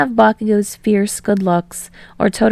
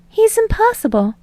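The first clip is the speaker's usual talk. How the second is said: put-on exasperated girl; 13 semitones higher than usual. A narrator's reading. Uraraka complaining to Kirishima.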